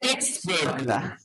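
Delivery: Ex-burglar slow, EX stressed, burglar light; Ex-burglar said slowly with weight on EX; slow stress drill. Shown as a demonstration. Teacher models the stress for the class, slow and marked. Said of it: "One more".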